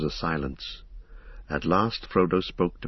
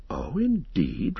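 slow, higher-pitched surprise; surprised; 5 semitones higher, much slower than usual. A narrator's reading. Frodo replies to Strider's demand to come along, surprised.

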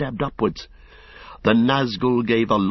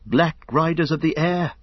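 higher, alarmed call to his companion; frightened cry; 7 semitones higher than usual. A narrator's reading. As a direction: cry out in dread at the sky. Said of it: "he cried".

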